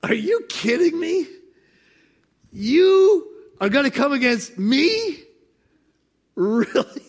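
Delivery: amused disbelieving God; God amused and incredulous. Acted. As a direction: scoff at the idea as almighty God. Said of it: "He's like".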